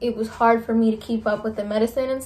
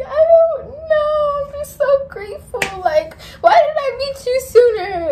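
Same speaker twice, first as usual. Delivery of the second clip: tearful gratitude; 3 dB louder than usual. Retold or imitated. Answering her friend why she's crying, tearful. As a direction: let the tears take over the words. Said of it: "So I just kept crying".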